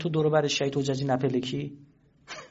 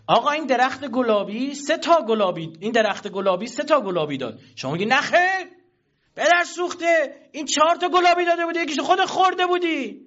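mock quarrel; 10 semitones higher, 4 dB louder than usual. Quarreling over pears, raised and shrill-free mock shouting. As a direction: argue like a petty neighbour, hammed up.

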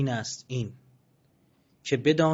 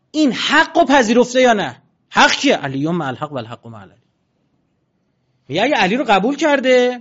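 much higher, much louder fiery sermon; preaching at full force; 9 semitones higher, 12 dB louder than usual. Acted. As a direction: thunder the question at the congregation. Preaching climax.

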